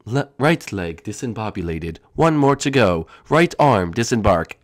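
low doomed monster voice; deep boss announcement. Acted. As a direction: declare defeat like a machine.

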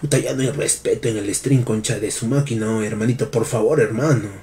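very low, soft wounded pleading; hushed, low-pitched disappointment; hurt reproach. Pretend. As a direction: reproach them quietly, as if hurt. Hurt reply to insulting donation message.

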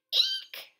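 tiny squeaking hamster cry; high squeak. Acted. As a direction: squeak it out in fright. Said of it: "I squeaked".

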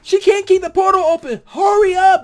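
urgent yells at the character; yelling at the screen. Retold or imitated. Vlogger shouting at Letty to hurry, frantic yell. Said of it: "I'm sitting there going".

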